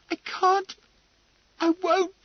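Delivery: put-on desperate girl near tears; panicked refusal. A narrator's reading. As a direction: cry out in distress.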